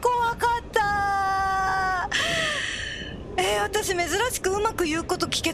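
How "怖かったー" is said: drawn-out scared wail; frightened cry out. Real, not acted. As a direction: stretch it out shaking with fear. Just escaped a scare. (怖さで叫ぶ)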